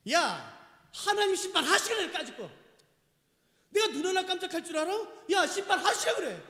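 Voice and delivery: high, loud mock bravado; brash, dismissive challenge, acted out; imitated defiant scoffing. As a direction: dare God like an insolent scoffer. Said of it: "그 말은 이 말입니다".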